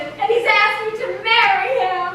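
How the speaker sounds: ordinary delivery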